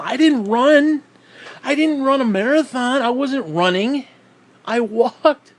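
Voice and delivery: can you believe it, all worked up; exasperated rant. Real, not acted. Exasperated rant about a walk.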